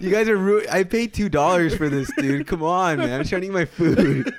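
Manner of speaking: hammed-up indignant pleading; mock complaint. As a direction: play an aggrieved diner, half laughing. Acted complaint of the disgusted onlookers.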